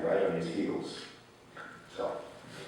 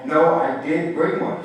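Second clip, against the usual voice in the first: higher, louder, slow narrated shout; boy shouting at grandfather, higher and louder; shouted protest; 8 semitones higher, 8 dB louder, slower than usual. A narrator's reading. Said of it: "he shouted".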